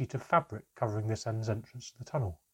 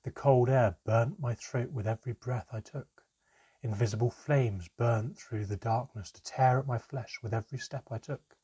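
ordinary delivery

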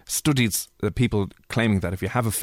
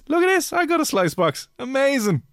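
excited. Real, not acted. Reading funny listener name aloud.

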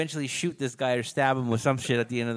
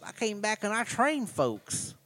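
slow, higher, hammed-up North Carolina accent; mock Southern accent; 7 semitones higher, 4 dB louder, slower than usual. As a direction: stretch out a cartoon North Carolina accent, louder. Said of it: "He's gonna be like".

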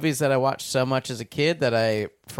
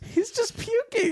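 high disgusted voice. Retold or imitated. Describing Mr Creosote.

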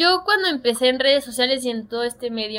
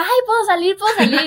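higher, louder eager plea; eager begging fan; 8 semitones higher, 4 dB louder than usual. Retold or imitated. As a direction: beg like a starstruck fan to get on camera. Fans begging to appear, retold.

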